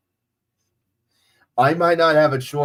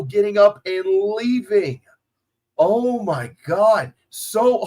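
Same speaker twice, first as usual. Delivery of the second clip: stunned amazement; 9 semitones higher than usual. Real, not acted. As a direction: marvel at the mass walkout as it happens. Stunned as fans leave stadium.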